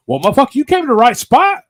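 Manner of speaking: high, loud, fast proud boast; offering moonshine to a singer, proud boast; excited boast. Retold or imitated. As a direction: brag it out with glee. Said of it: "and I'm like".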